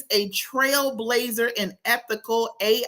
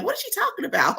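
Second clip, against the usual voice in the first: curious audience; 11 semitones higher, much faster than usual. Retold or imitated. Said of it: "I want y'all to be like".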